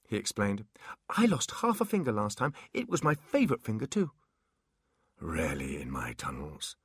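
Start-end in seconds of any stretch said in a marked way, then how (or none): 1.1-4.1 high, eager little imp voice; bright nervous imp chatter. Acted. Imp character voice. 5.2-6.8 put-on low, hushed old warlock; proud old wizard, half whispered.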